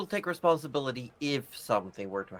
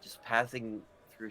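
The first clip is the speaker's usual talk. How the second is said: ordinary talk, nothing marked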